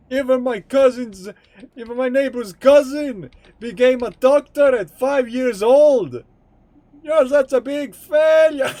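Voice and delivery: scolding strict dad, building to a high, loud yell; hammed-up furious parent tirade.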